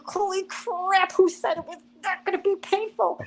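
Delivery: mock agony, incredulous. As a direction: stretch out each word as if it hurts. In pain during shading.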